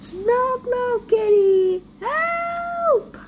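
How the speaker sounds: high, wailing cry for help. Acted. The squeezed kitty wailing high for help. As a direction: squeal it out, scared.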